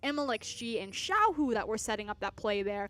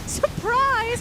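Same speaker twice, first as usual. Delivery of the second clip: mock surprise; 12 semitones higher, 9 dB louder than usual.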